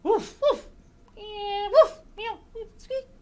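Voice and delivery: high imitated woofs, meow and squeak; quick medley of pet sounds; yip, mew and squeak like animals; animal noises. Animals make their noises.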